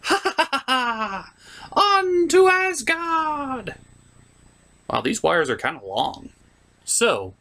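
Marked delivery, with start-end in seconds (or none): 0.0-1.3 high, slow villain cackle; slow evil laugh. Acted. 1.7-3.8 "On to Asgard" high and very slow; grand slow proclamation. Acted. Second take, grand and slow.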